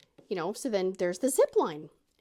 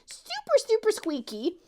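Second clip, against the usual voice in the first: super squeaky; 11 semitones higher, 3 dB louder than usual. Shown as a demonstration. Woman showing how her nervous voice sounded, squeaky. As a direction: jump up into a high squeak. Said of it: "my voice is getting all".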